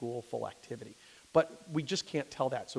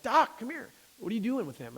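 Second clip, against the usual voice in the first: calling out dad; 9 semitones higher, 4 dB louder than usual. Retold or imitated. Father calls out to doctor.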